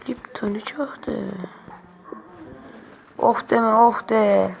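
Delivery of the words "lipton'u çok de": whispered "Lipton'u çok de"; murmured whisper. Real, not acted. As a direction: whisper the phrase to yourself. Muttered catchphrase while playing.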